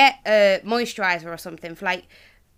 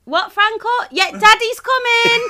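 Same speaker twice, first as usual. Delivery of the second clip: calling out; 14 semitones higher, 9 dB louder than usual. Real, not acted. Parent answering Franco mid-podcast, loud high call.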